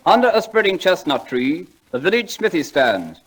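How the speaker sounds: slow, lofty recitation; poem recital. Acted. [name] reciting Longfellow to the audience, slowly and grandly. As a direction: declaim each line like a school prize reciter.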